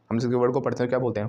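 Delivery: ordinary talk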